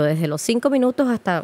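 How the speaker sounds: ordinary talk, nothing marked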